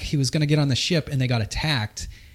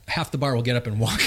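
ordinary delivery

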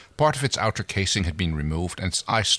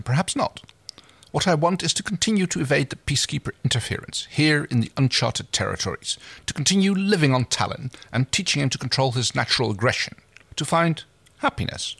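Crace, pitched higher, proud and persuasive; lightly voiced scheming captain, raised pitch; 8 semitones higher than usual. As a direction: reader lifts her voice for Crace's pitch.

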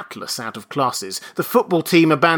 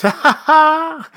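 higher, louder, slow jeering laugh; mocking laughter; 8 dB louder, slower than usual. A narrator's reading. Undergraduates jeer at Benjamin, mocking laughter. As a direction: hoot with mockery. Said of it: "the undergraduates laughed".

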